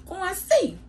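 sudden squeaky high voice; high squeak. Shown as a demonstration. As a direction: jump up to a squeak on see. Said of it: "And go and see".

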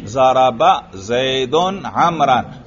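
slow, deliberate teacher's example; slow model recitation. Shown as a demonstration. Teacher asks students to attend to the example sentence, slow model recitation. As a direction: draw out each Arabic word for the class. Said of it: "तवज्जो है".

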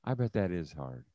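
soft, low, gentle sympathy; sympathetic. Shown as a demonstration. Demo for leaders of how to listen, soft and sympathetic. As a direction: say it softly as you nod. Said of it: "you nod your head and go".

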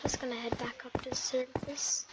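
hushed whisper, almost no voice. Real, not acted. Player sneaking back up to the surface, whispering. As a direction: whisper it so the mobs can't hear.

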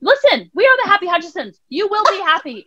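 high, emphatic parental decree; imitated insistent mom; mock lecturing mother. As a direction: order a small child to be happy. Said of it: "I remember one going".

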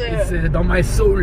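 put-on slow hammy French accent. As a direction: drag it out in a comic French accent. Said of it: "speaking in a French accent all morning".

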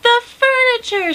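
horrified high wail at a discovery; shocked cry. A narrator's reading. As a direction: cry it out loud and high, stretching the words. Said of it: "she cried".

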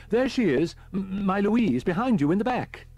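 high, loud, overwrought voice; hysterical. A narrator's reading. Distraught man points Holman to the back seat, hysterical. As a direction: announce it in a high-pitched frenzy. Deranged man shows his wife.

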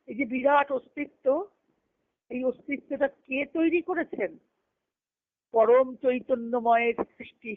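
normal talking voice

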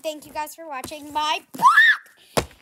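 loud, slow, drawn-out thanks; loud sign-off. Real, not acted. Vlogger thanking viewers at the end, loud slow call.

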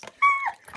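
high, loud delighted laugh; giddy laugh. Real, not acted. Fan laughing giddily after getting the trolls.

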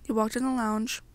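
ordinary delivery, nothing marked

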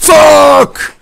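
high, loud scream of rage; real angry scream; furious shout. Real, not acted. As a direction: explode at the goal. Concedes goal, screams.

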